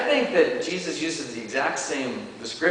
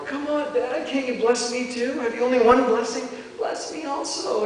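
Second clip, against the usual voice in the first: much higher, near-tearful begging; pleading Esau; 10 semitones higher than usual. Acted. Esau begs his father for a blessing. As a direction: plead with the father as if about to cry. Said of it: "And he starts crying".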